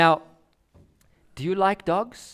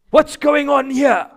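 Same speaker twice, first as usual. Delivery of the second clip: high, loud startled dog voice; waking dog; 7 semitones higher, 8 dB louder than usual. Retold or imitated. Sleeping dog woken by the gate, startled voice.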